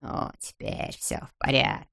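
soft, low relieved murmur; thinking to himself. A narrator's reading. Miser hears rescuers return, relieved inner murmur. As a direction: murmur it privately. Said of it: "подумал он".

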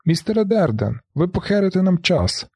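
high urgent shout; shouting from behind. A narrator's reading. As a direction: yell after him as he climbs out. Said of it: "механік з бійцівського клубу кричить позаду". (гучний вигук ззаду)

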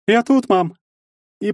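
high, loud casual holler; carefree shout back. A narrator's reading. Son answering his mother's call, shouting casually. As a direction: call back as if nothing happened. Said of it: "крикнул, как ни в чём не бывало".

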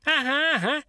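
very high gleeful dwarf squeak; high-pitched triumphant cheer; joyful squeak. A narrator's reading. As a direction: crow happily like a tiny imp. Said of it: "радостно запищал карлик".

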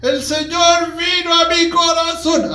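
retold frantic yell, slow and high; desperate shouting. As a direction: shout it out like a desperate street crier. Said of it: "a esos que gritan, pero desesperado".

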